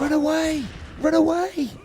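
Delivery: high comic cry; shouting. Pretend. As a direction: yell it in a panic.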